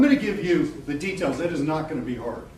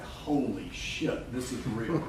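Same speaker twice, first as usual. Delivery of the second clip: hushed and slow, barely voiced in awe; stunned hush; 3 semitones lower, 7 dB quieter, slower than usual. To himself at the Amazon screen, a slow stunned hush. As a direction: breathe it out, stunned and drawn out. First online dollar, stunned.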